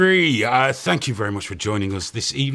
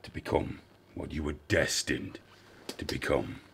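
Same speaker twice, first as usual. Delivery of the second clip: ominous deep voice; 11 semitones lower, 11 dB quieter than usual. Acted. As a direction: intone it like a dark prophecy. Mock ritual skit opening.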